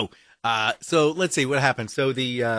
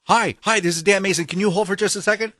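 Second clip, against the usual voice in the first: higher, louder bright office phone greeting; cheery phone voice; 6 semitones higher, 5 dB louder, faster than usual. Retold or imitated. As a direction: play yourself juggling two calls. Dan acts himself on phone call.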